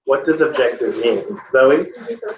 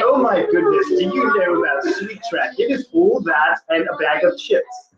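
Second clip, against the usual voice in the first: British rapper voice; 4 semitones higher than usual. Acted. Teacher attempting rapper slang for the class, fake English accent. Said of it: "with an English accent and try to talk with that language".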